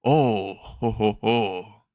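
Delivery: a hint of villainous chuckle; lightly voiced villain. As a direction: toy with an approaching rival, mockingly. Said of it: "Sludge villain says".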